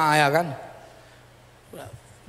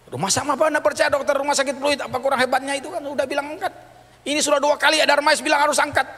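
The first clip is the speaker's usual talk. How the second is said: exasperated retelling; 10 semitones higher than usual. Retold or imitated. Family retelling doctor's verdict.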